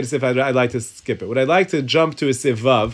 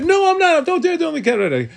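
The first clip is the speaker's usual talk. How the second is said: very high-pitched, hammed-up protest; mock indignant protest. Rabbi playing the accused denying he's a Democrat, mock indignant. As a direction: protest in a squeaky-high voice, drawing out the end.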